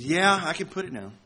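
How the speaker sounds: frustrated, huffy snap. Retold or imitated. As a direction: sound annoyed and put out. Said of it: "In a kind of a frustrated and a huffy tone".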